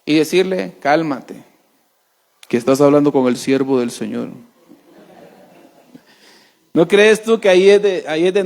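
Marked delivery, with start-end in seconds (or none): 0.8-4.3 low, gentle calming voice; calm, soothing. Pretend. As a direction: soothe an upset wife. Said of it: "debes de proveer paz".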